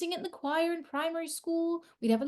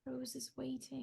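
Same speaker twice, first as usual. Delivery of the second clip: quiet; 4 semitones lower, 12 dB quieter than usual. Acted. Devastated quiet line.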